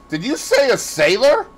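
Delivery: urgent high, loud question; crying out. A narrator's reading. Dorian questions the gamekeeper about the sailor, crying out. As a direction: demand confirmation, voice leaping up. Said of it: "he cried out".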